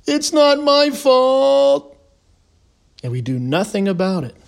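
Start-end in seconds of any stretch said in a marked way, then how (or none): 0.1-1.9 very high, long drawn-out mocking whine; whiny mock complaint. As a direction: stretch it into a loud, slow whine. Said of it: "all he does is whine".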